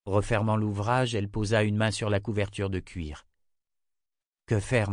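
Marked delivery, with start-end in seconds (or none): none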